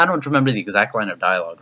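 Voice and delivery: usual speaking voice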